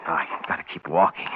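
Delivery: ordinary talk, nothing marked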